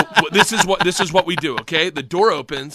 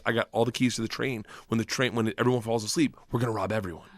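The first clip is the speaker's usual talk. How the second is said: low, soft gangster voice; conspiratorial; 9 semitones lower, 9 dB quieter than usual. Retold or imitated. Gangster revealing the robbery plan, conspiratorial. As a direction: lay out the plan low and quietly.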